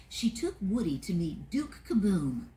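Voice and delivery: ordinary delivery, nothing marked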